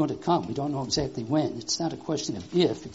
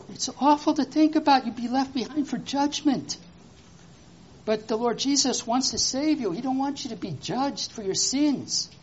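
higher, impassioned preaching voice; earnest preaching; 9 semitones higher, 3 dB louder than usual. Real, not acted. Preaching to children.

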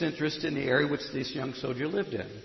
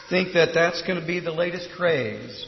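ordinary delivery

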